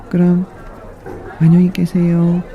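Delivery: high, fast cheerful mouse; high skipping farewell; mouse goodbye. Acted. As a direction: chirp a quick goodbye.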